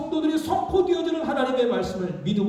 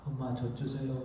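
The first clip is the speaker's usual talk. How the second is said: polite request; 7 semitones lower, 12 dB quieter than usual. Pretend. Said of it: "이게 아니에요".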